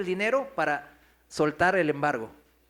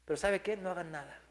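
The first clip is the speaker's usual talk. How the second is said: retold crying; 9 dB quieter than usual. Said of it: "llorando nos dijo".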